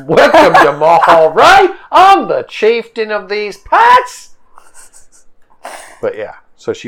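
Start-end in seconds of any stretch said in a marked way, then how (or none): 0.1-4.4 slow, high and loud regal chieftainess greeting. Acted. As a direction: announce it grandly as the tribe's chieftainess. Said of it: "an accent that I'm not gonna do".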